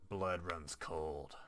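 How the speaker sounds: slow ominous trailer voice; dramatic trailer read. Acted. Reading an achievement name, slow trailer voice. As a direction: draw the title out like a movie trailer.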